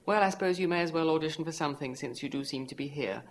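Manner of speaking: unenthusiastic. A narrator's reading. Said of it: "About as enthusiastic as if he was going to get a tooth extracted".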